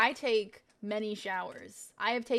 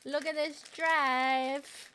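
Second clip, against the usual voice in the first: silly slow voice; 5 semitones higher, much slower than usual. Pretend.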